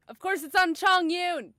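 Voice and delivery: loud, frustrated cry; exasperated outburst. Real, not acted. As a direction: yell it in disbelief at bad luck. Unwanted gacha result, exasperated.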